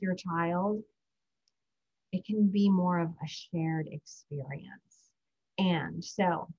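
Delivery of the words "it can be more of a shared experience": ordinary delivery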